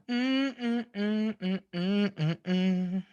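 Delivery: soft hum stepping down from high; melodic humming. Real, not acted. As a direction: hum a little descending run. Hums a descending tune.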